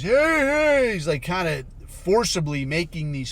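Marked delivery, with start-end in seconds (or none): 0.0-0.9 loud, high mock politician's hey; imitating his speech yell. Mimicking him during his speeches, mock high call. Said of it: "some of these speeches, and he's".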